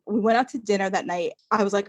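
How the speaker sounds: usual speaking voice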